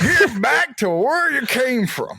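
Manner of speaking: mock stern official. Mocking airports sending travellers home.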